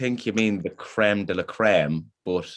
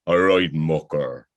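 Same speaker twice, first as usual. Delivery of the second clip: lightly put-on Belfast accent, slow; a hint of a Belfast bus driver's accent; 3 semitones lower, 4 dB louder, slower than usual. Student greeting the Belfast bus driver, mild Belfast accent. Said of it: "thick Belfast accent".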